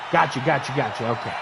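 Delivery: low, soft quick aside; muttered aside. Real, not acted. Streamer dismissing something mid-match, low and soft. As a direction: mumble it to yourself.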